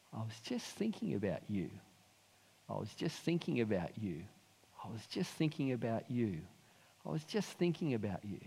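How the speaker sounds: soft, intimate repetition with pauses; quietly reassure, as God speaking to one person; gentle divine reassurance. Acted. Voicing God's gentle message to him.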